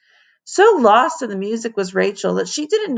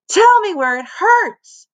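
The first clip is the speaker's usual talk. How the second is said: nurse voice; 12 semitones higher, 5 dB louder than usual. A narrator's reading. Nurse addressing Rachel on cot.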